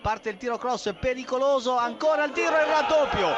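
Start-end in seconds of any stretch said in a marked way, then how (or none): 1.8-3.3 excited goal call. Real, not acted. Goal call in live commentary.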